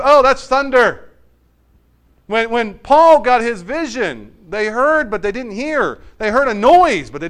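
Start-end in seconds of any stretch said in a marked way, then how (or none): 0.0-1.1 slow, loud, higher shrug-off: oh, that's thunder; dismissive bystanders. Retold or imitated. Crowd shrugging off God's voice, slow and dismissive. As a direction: brush it off as mere noise. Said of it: "everyone says".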